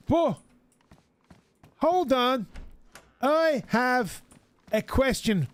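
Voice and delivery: high, slow, over-careful English; slow tourist English. Retold or imitated. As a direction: drag each word out for a foreigner. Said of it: "slowing down their English tune".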